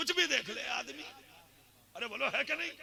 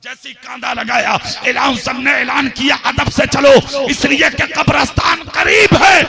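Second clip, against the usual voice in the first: much louder sermon delivery; full-force preaching; 6 semitones higher, 27 dB louder than usual. Acted. Preacher telling the crowd the dream story, roaring sermon. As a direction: roar the tale over the gathering.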